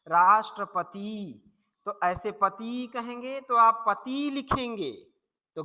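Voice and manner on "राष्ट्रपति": राष्ट्रपति said slowly, every sound clear, short final ति; correct pronunciation, slow. Shown as a demonstration. Teacher modelling the right way for students, slow and precise. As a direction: spell out राष्ट्रपति carefully. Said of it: "आप कहिए राष्ट्रपति".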